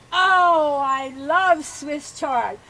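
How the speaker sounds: high, slow, swooning praise of chard; gushing teacher. Retold or imitated. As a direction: gush over it like a delighted teacher. Mimics teacher's gushing delight.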